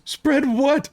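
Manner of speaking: high-pitched, alarmed suspect; alarmed question. Acted. Suspect told to spread them by the guard, high alarm.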